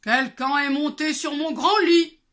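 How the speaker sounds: loud grumbling papa bear; big bear growling. Acted. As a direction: roar it like a big angry bear. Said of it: "gronda de sa grande voix".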